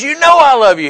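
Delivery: high-pitched mock outburst; exasperated, ready to cuss. Preacher replaying Peter's answer to Jesus, exasperated. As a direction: imitate someone protesting, at the end of his patience. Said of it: "he was ready to cuss again".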